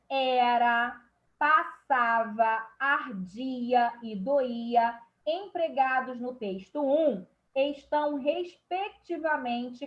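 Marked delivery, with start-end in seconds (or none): 0.1-5.0 slow. Shown as a demonstration. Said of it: "reads the verbs aloud".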